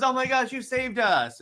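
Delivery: high, excited gratitude; cheering civilian. Acted. Civilian cheers the heroes who saved them, high and excited. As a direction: gush it like a rescued bystander. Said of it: "everyone's cheering on them".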